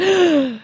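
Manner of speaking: high, sharp mock gasp of outrage; hammed-up gasp. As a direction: gasp like a scandalized boyfriend. Drew reacting jealously.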